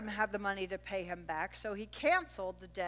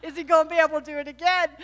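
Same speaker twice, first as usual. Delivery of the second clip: higher, louder, fast fretting; anxious worry; 6 semitones higher, 8 dB louder, faster than usual. Retold or imitated. Wife fretting over her husband's strength, fast and anxious. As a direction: rush the worried thought. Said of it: "I'm thinking".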